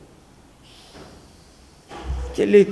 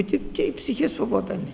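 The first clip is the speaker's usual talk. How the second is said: ordinary talk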